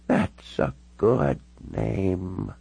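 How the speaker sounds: put-on very slow, halting elderly voice; frail old lady, each word drawn out; very old woman. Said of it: "She looked all of ninety-six years".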